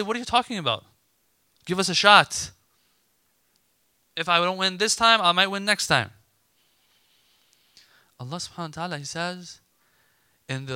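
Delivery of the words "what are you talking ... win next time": louder, higher voice of insistent athletes; acted protest. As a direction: argue back for another chance. Voicing determined athletes protesting.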